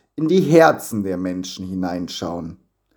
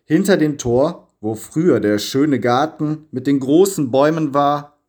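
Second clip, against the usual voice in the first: ordinary delivery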